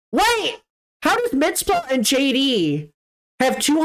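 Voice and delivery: sudden high surprised "Wait!"; startled high exclamation. Real, not acted. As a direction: catch yourself in surprise. Spots odd leaderboard score.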